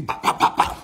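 loud, percussive voiceless bops; imitated punches. Storyteller acting out blows landing on James, loud imitated punches. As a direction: pop out each hit as a sharp mouth noise.

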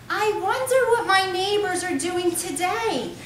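high, slow, prissy mock lady voice; high-pitched voice. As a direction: lift it way up and drawl it like a dainty neighbor. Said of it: "He continued in a high-pitched voice".

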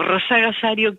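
"Rosa Gasario" said as Rosario with the extra "ga" syllable slipped in; rosarino slang word. Shown as a demonstration. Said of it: "quiere decir Rosario". ("Rosa Gasario" dicho en jerga rosarina, con la sílaba "ga" intercalada)

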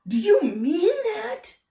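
can't believe the good luck; exclaimed. Acted. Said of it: "exclaimed Mister Bear".

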